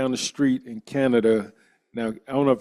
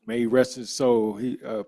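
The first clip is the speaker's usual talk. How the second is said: ordinary talk, nothing marked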